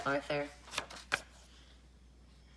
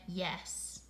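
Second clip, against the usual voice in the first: plain reading voice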